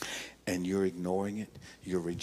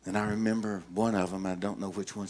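usual speaking voice

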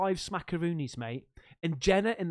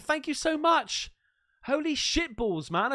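excited thanks; 8 semitones higher, 4 dB louder, slower than usual. Real, not acted. Thrilled by a donation.

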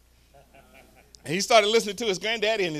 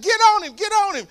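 yelling from the sidelines, much higher and louder; screaming; 11 dB louder than usual. Retold or imitated. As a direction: scream it like a fired-up grandpa. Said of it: "He's like".

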